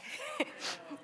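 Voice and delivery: a short, soft, embarrassed laugh while teary; embarrassed laugh through tears. Real, not acted. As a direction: laugh it off awkwardly after crying. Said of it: "This is embarrassing".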